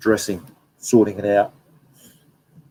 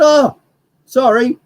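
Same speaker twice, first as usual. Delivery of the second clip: high startled apology; 7 dB louder, slower than usual. Retold or imitated. Retelling apology to a close bird.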